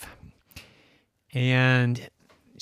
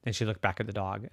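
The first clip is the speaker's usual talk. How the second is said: plain reading voice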